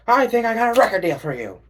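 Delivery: high, loud, eager record-label pitch; slick talent scout. Pretend. A talent scout pitching a record deal, high and eager. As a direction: sell it like a sleazy music agent.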